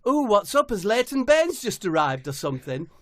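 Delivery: high, loud mock surprise; high comic voices. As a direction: squeal it in surprise. Mum and Debbie.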